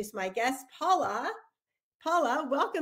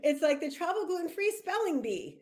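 laughing; 8 semitones higher, 5 dB louder than usual. Real, not acted. Host laughing along.

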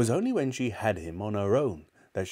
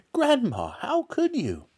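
high, shocked protest; appalled boy. A narrator's reading. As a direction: reproach granny as a horrified child.

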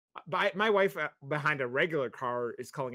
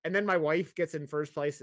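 ordinary talk

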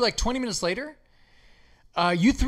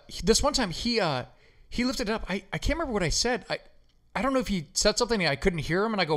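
ordinary delivery

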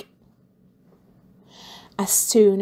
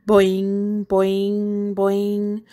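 springy boing noises; bouncing sound; much slower than usual. A narrator's reading. Narrator imitating balls bouncing, springy boings. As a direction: bounce each boing like a ball.

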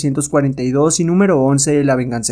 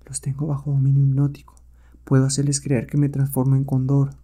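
soft, even inner voice; concentrating hero; 5 dB quieter than usual. Acted. Hero thinks to himself while hypnotizing the apes, concentrated. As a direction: think it quietly, with confidence.

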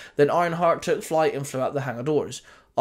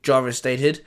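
usual speaking voice

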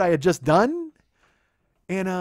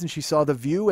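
usual speaking voice

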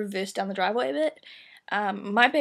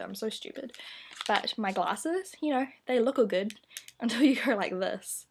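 usual speaking voice

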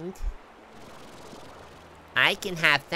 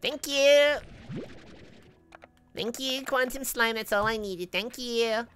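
delighted; 5 semitones higher, slower than usual. Real, not acted. Delighted at finding item.

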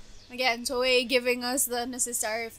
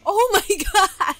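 louder exclamation broken by a laugh; laughing outcry; 5 semitones higher, 7 dB louder than usual. Real, not acted. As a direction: laugh out the words in disbelief. Laughing in shock.

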